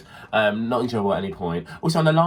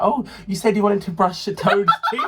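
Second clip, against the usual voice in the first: mock indignation; 10 semitones higher, 6 dB louder than usual. Mock irritated child's retold complaint.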